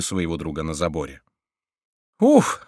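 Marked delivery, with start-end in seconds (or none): 2.2-2.7 sharp, very high puff of relief; high relieved gasp. A narrator's reading. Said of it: "вскричал Фриар".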